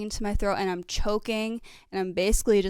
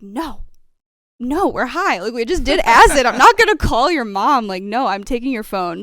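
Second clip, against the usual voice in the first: raised, insistent voice refusing; loud refusal; 4 semitones higher, 10 dB louder than usual. Retold or imitated. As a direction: shut her down firmly and loudly. Friend refusing during acid trip.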